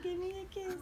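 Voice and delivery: put-on high, soft baby voice; voicing the baby. Mother voicing the baby to daddy, high and soft. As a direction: ask for a kiss in a tiny voice.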